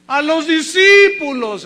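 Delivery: loud, slow, weighty answer; "A los discípulos" proclaimed loud and drawn out; emphatic declaration. Real, not acted. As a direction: proclaim it to the congregation. Preacher answers his own question.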